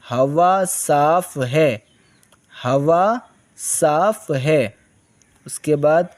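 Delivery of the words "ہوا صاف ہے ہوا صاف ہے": slow dictation. Shown as a demonstration. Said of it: "teacher reads slowly".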